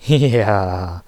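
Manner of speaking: drawn-out, mocking laugh into the word; sneering laugh. A narrator's reading. Boss gloating over exposed employee, sneering laugh. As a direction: laugh scornfully at your victim. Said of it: "Krawulke lachte höhnisch".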